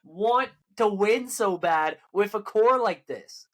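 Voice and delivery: high-pitched, incredulous outburst easing down; exasperated disbelief. Real, not acted. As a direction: demand an answer, utterly baffled. Rant about ownership wanting to win.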